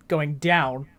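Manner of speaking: normal talking voice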